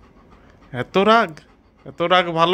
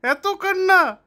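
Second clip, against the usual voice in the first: much higher, teasing "কান্না" chant; mocking singsong; 10 semitones higher than usual. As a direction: needle the child about the tears. Mocking the child's crying. (অনেক উঁচু সুরে ব্যঙ্গ করে "কান্না")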